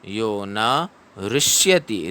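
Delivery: ऋष्यति with the ri of ऋषि, drawn out; teacher reciting slowly; slow recitation. Shown as a demonstration. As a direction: slowly chant यो न ऋष्यति with ऋष्यति as "rishyati". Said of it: "री से ऋषि वाला री पढ़ना है".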